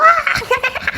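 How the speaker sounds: very high acted kid cackle; high mock giggling shriek; child's wild laugh. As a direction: squeal like a child on a hitting spree. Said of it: "그래도 아이가 막".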